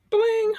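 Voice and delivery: high, tiny chime-like "bling"; bling sound effect. Retold or imitated. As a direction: sing out a little ding.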